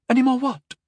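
put-on high-pitched puzzled girl; bewildered, girlish question; mystified. A narrator's reading. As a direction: ask it high, completely baffled. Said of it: "the girl asked, mystified".

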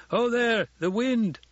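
high, loud call from afar. A narrator's reading. Lookout warns the men below of wind, calling out. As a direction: call a warning down from a ridgetop. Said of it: "A voice called out from the ridgetop".

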